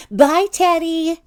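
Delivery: high, loud, drawn-out farewell; cheery goodbye. A narrator's reading. You leave next day, calling cheerily to Teddy. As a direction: call out a singsong goodbye.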